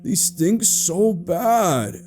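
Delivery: slow, high, drawn-out whine; boy groaning in disgust.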